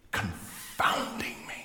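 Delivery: slow, drawn-out emphatic whisper; dramatic whisper. Retold or imitated. Preacher quoting a convert confounded by the Word, slow dramatic whisper. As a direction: stretch the word out in an intense whisper. Climax of quoted convert's testimony.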